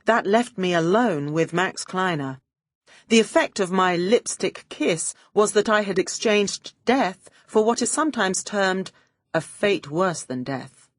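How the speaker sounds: ordinary delivery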